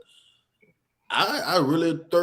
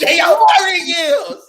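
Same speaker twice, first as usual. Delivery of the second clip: high, loud, laughing shout; 13 semitones higher, 9 dB louder than usual. Real, not acted. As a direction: cry it out laughing. Laughing joke quote.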